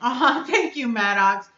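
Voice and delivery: usual speaking voice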